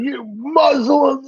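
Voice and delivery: mock blunt accusation. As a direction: drag it out like a clueless bigot. Mocking what people expect.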